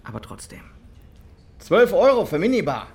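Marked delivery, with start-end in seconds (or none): 1.6-2.9 laut und höher, empört; empörter Ausruf. Acted. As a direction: die Rechnung anprangern. Empört über Minibarrechnung. ([name] regt sich über die Minibar auf, laut)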